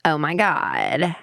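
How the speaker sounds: put-on slow Cali girl with creaky, sinking phrase ends; drawn-out Cali girl accent. Shown as a demonstration. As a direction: do a whiny California valley girl accent. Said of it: "we're gonna start with Cali girl".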